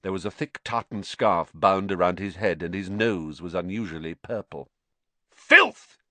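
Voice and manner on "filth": very high, loud outraged shout; furious shout. A narrator's reading. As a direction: explode on the word in outrage. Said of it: "he shouted".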